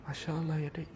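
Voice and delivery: very soft, low husband murmur; empati suami. Shown as a demonstration. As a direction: quietly marvel in sympathy with your wife. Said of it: "berikan empati". (suara suami sangat lembut berempati)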